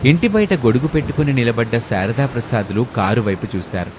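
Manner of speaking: ordinary delivery, nothing marked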